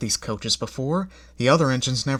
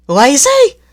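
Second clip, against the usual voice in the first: high, loud rising Lazy?; first indignant echo; offended question; 12 semitones higher, 12 dB louder than usual. A narrator's reading. Coaches offended, repeating.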